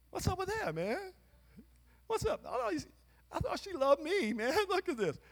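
very high squeaky puzzled dog; confused dog voice. Pretend. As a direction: play the betrayed dog whining. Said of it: "your dog would be going like".

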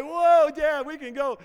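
imitating his excited daughter. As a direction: squeal it like a kid who can't believe it. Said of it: "Kay was more excited than I was".

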